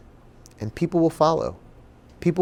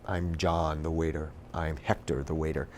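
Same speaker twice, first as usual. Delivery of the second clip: low, soft self-talk; quiet, low inner voice; talking to oneself; 6 semitones lower, 11 dB quieter than usual. Retold or imitated. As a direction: rehearse a new name privately, under your breath. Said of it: "I'd say to myself".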